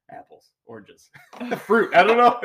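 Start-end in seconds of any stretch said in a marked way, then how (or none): 0.1-1.1 hushed, unvoiced listing; whispering. Real, not acted. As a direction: whisper the words under your breath. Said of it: "Why are you whispering?".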